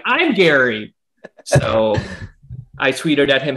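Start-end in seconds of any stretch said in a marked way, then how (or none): 0.1-0.9 higher, delighted announcement; excited exclamation. Retold or imitated. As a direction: pipe up, thrilled to be named. Retells thrilled reaction to own name.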